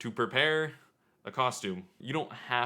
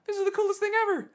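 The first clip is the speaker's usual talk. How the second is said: very high, fast, loud gush; excited squeal; 7 dB louder, faster than usual. Retold or imitated. Visitor admires vendor's handmade stuff, fast high excited squeal. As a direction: squeal it out like a giddy fan. Retold awe at the vendor's shack.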